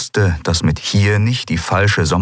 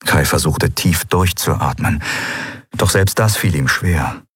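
ordinary delivery, nothing marked

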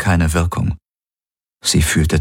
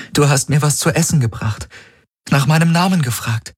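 higher, earnest female voice pleading; pleading young woman; 9 semitones higher than usual. Acted. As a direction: urge him to remember. Kirai pleads with Garlin.

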